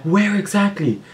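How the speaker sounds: high-pitched, slowed furious mom impression; mock angry mom. Mom on phone to daughter out all night, high mock yell. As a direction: play mom losing it on the phone.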